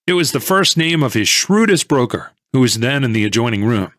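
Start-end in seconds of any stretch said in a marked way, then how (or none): none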